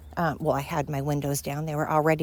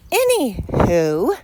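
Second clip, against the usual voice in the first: slow, higher, louder drawn-out anywho; silly anywho; 5 semitones higher, 8 dB louder, much slower than usual. Acted. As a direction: stretch it out playfully. Playful sign-off.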